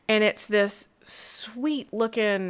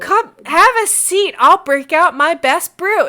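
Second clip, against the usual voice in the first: loud, much higher booming welcome; hearty welcome; 7 semitones higher, 11 dB louder than usual. Acted. Grateful innkeeper welcomes his new patron, booming. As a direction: throw open the hospitality, booming.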